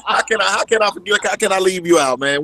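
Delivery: high, loud, tickled protest; laughing protest. Real, not acted. Guest playfully asks how he could forget the host, laughing. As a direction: insist through real laughter.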